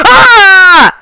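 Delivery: startled scream, high and loud; panicking gamer. Real, not acted. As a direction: shriek in a real jump scare. Jump scare in horror game.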